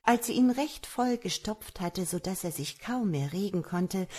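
ordinary delivery, nothing marked

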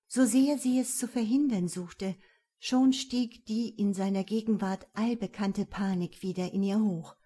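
ordinary delivery

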